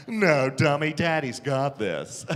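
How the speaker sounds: low, slow cocky brush-off. Retold or imitated. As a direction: lay on the smug confidence, deep and unhurried. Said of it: "I was all cocky".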